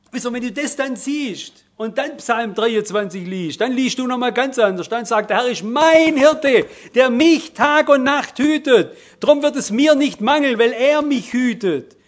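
climactic preaching. Acted. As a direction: cry the psalm out in triumph. Preacher quoting Psalm 23 climactically.

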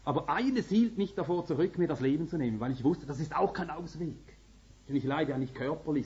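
usual speaking voice

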